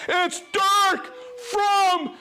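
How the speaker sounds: drawn-out arena-style introduction; announcer shout. Pretend. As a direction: boom it out like introducing a champion. Announcing returning viewer like a champion.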